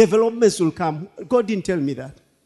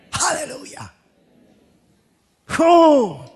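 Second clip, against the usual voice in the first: high cry of hallelujah; ecstatic praise; 9 semitones higher, 4 dB louder, slower than usual. Real, not acted. Preacher crying out in praise, high. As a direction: let the praise burst out.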